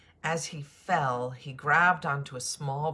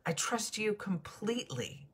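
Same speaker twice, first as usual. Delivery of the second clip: resolutely; 8 semitones higher than usual. Acted. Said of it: "the man said resolutely".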